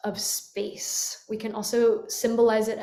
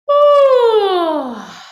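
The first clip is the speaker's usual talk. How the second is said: loud, much higher yawn-sigh oh; 10 semitones higher, 12 dB louder than usual. Shown as a demonstration. Teacher shows a yawn sigh, loud open oh. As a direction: open into a big falling yawn. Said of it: "Just go".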